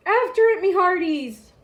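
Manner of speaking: loud, high captain's order; commanding. A narrator's reading. Braidbeard commands the crew, loud and high. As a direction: order the crew after the ball. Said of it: "Braidbeard commanded".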